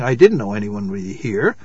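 a touch of defensive resentment; resentful protest. A narrator's reading. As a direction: protest with mild resentment, keeping it low. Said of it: "she protested".

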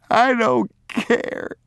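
put-on high, breathless sobbing child's voice, slow. Boy defying his father while sobbing, high and slow. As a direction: choke the words out through sobs as a small boy.

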